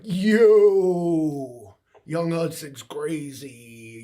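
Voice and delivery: long, loud, drawn-out "Yo"; imitated amazement. Quoting Australians reacting to Young Hudson's Vegemite feat, mock amazed. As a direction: mimic an astonished crowd, stretching the words.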